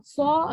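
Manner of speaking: thick "so" with lips a little forward; heavy, half-rounded vowel in "so"; slightly forward lips. Shown as a demonstration. Teacher contrasting with thin letters, thick forward sound. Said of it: "agak maju".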